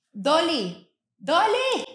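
soft, high coaxing call; calling the dog. Real, not acted. Host calling her dog Dolly mid-recording, high and soft. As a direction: lilt it up to call a pet.

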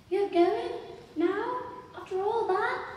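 put-on high, loud child's challenge; challenging child. A narrator's reading. Child challenging the adult who is leaving. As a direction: fire short questions up high, like a child. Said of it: "almost a challenge in the way the child spoke".

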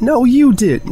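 high, loud angry retort; heated quarrel shout; shouting back. Acted. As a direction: yell it back childishly. Friends quarrel, yelling.

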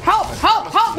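panicked help calls in a firefight; calling for help. Real, not acted. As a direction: yell for help, fast.

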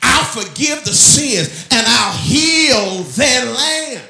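high, very loud scripture proclamation; full-force preaching. Real, not acted. Preacher declaring God's promise to the church, full-force preaching. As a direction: thunder the verse out slowly, phrase by phrase.

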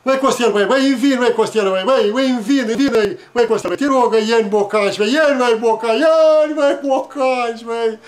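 put-on loud, insistent childlike pleading; tearful begging. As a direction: beg your brother over and over, loudly. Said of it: "plângând să ne iei niște bocanși".

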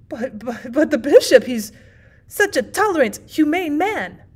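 stammering, then loud and high; flustered protest. A narrator's reading. Shocked host defending the bishop, flustered. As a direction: stumble in, then protest emphatically.

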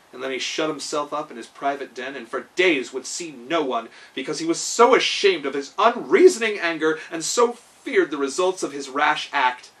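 ordinary delivery, nothing marked

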